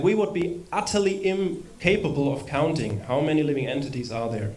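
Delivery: ordinary talk, nothing marked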